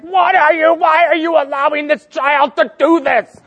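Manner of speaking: high, loud scolding disbelief; exasperated wife. Retold or imitated. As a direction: scold him like a fed-up wife. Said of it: "Reg is just like".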